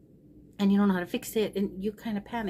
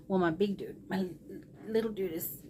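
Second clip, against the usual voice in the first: ordinary talk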